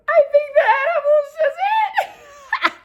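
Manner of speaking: squealing high, loud laugh-cry; barking laughing. Retold or imitated. High daughter confessing to her mother, laughing hysterically. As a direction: wail it slowly through hysterical laughter. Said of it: "barking laughing".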